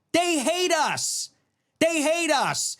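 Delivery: high insistent. Real, not acted. Angry rant about enemies.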